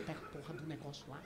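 very soft, under the breath; hiss-quiet order into someone's ear; hushed urgent aside. Acted. Secretly ordering the waiter at his ear, hushed. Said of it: "E falando baixinho no ouvido do cara".

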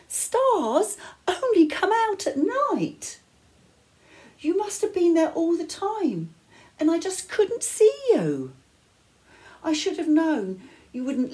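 overflowing with relief and joy; joyful cry. A narrator's reading. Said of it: "she cried".

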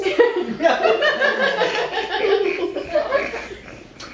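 bursts into high giggling; can't hold it together, cracks up laughing; helpless high-pitched laugh; real laughter. Real, not acted. Breaks up mid reading.

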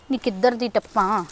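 grumbling aside. Acted. Daughter-in-law grumbling alone.

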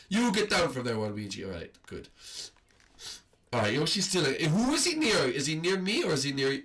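ordinary talk

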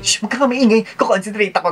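imitating the friend.

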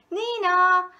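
high, loud siren wail; police siren. A narrator's reading. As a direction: sing out like a siren.

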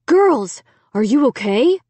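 alarmed cry. A narrator's reading. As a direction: cry out to check on the girls. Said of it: "Dad cried".

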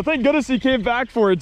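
ordinary talk

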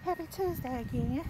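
ordinary delivery

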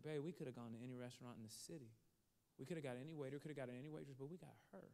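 soft, low private plea; hushed persuasion. Retold or imitated. Husband persuading wife about their waitress, hushed. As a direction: make the case under your breath.

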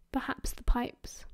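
ordinary delivery

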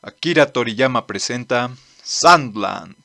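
ordinary delivery

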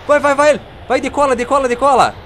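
high, loud frantic shouting; panicked yell at the plane. Real, not acted. Plane overshoots runway.

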